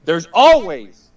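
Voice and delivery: loud, slow, drawn-out yell; fired-up yell. Real, not acted. Guest fired up about the situation, yelling at listeners. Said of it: "and I'm gonna yell, I'm sorry, I'm fired up".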